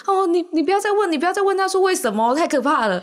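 mock panic. Friend begging the others not to ask why, high mock panic. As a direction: stutter like a scared teen.

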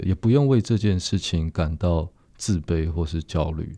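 normal talking voice

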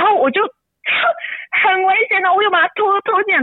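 breaking into tears mid-sentence; crying while telling. Real, not acted. Mother recalling dragging her son from the road, crying. Retelling dangerous struggle, crying.

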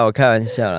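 usual speaking voice

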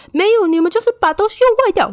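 high, loud, fast scolding in a put-on professor voice; mock angry professor. Professor insists the students broke it, high fast mock scolding. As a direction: snap back like the accusing professor. Teacher accusing student, retold.

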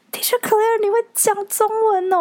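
put-on high, little kid voice full of wonder; surprised child. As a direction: squeak it like an amazed preschooler. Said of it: "非常惊讶".

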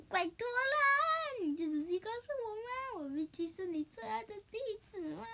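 hammed-up girly begging; girly pleading. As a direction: coax like a cutesy girl begging. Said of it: "河马就装扮成女生".

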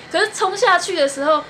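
ordinary talk

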